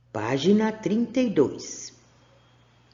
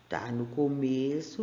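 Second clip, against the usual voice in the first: ordinary delivery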